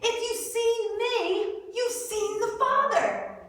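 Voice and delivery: slow, high-pitched, weighty delivery; emphatic declaration. Retold or imitated. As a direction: draw the words out like a teacher pressing the point. Jesus's emphatic answer acted out.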